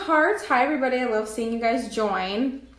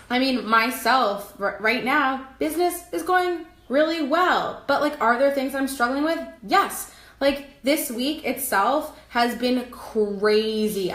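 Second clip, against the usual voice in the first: ordinary talk, nothing marked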